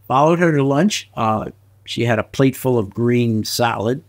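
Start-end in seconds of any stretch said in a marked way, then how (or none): none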